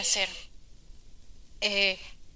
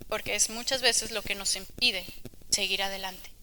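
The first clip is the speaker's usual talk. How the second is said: ordinary delivery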